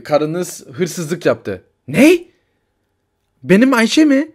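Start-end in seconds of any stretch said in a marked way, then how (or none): 1.9-4.3 higher, louder disbelief; can't believe it; stunned. Acted. Husband hearing his wife was arrested, stunned.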